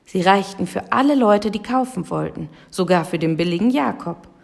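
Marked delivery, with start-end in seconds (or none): none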